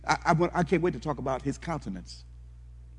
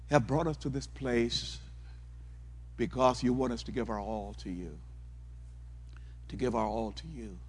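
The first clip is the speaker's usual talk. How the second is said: prayer; 2 semitones lower, 6 dB quieter than usual. Acted. Preacher praying softly over his congregation. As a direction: pray gently over the people.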